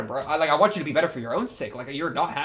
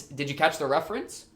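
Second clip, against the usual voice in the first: ordinary delivery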